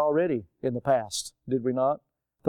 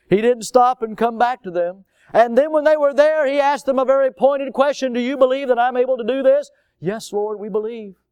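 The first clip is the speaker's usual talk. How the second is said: loud, high preaching, quick on the question; preaching at full force; 7 semitones higher, 5 dB louder than usual. Real, not acted. Pastor retelling Jesus's question to the congregation, preaching loud and high. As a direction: drive the story home at full volume.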